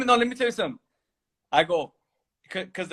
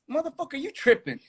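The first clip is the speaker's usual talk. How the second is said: retold comeback; 10 semitones higher, 3 dB quieter than usual. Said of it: "I was like".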